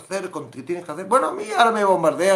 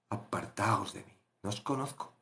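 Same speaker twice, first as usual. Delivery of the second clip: much softer, low final judgment; the Lord's quiet, low sending away; low quiet dismissal; 8 semitones lower, 14 dB quieter than usual. Acted. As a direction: pronounce it coldly, low. Jesus casting them away.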